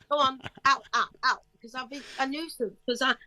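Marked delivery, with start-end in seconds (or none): 0.1-1.4 angry teacher. Retold or imitated. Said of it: "teacher scolding her in class".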